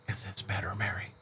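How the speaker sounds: breathy whisper, barely voiced. Shown as a demonstration. Whispering to a chat member to prove he isn't yelling. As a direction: drop to a whisper so Mary can relax. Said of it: "I can talk like this".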